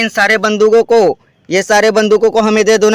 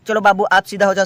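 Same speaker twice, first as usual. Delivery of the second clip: ordinary talk, nothing marked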